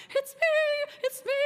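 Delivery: an angel who can't wait to be picked; eager angel. Retold or imitated. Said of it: "He's like".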